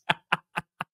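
soft chuckle. Real, not acted. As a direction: let the laugh trail off. Keeps laughing at audience.

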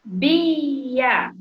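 BIA stretched out as Bi-a; syllable by syllable. Shown as a demonstration. Teacher counting syllables with children, Bia stretched out. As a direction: draw "Bia" out, each syllable held. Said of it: "quantas vezes eu abri a boca".

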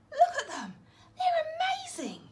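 high, loud, laughing delight; wide-eyed fan; thrilled. Acted. Norman thrilled by snails.

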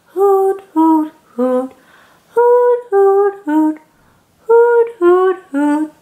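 high, loud hooting owl; owl hoots. Acted. Reading to a child, hooting like an owl. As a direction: hoot it like an owl.

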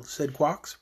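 plain reading voice

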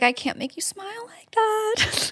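high, soft, slow sulky mock voice; mock pathetic whine. Mocking the sulky boyfriend to her co-host, soft high whimper. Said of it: "He's like".

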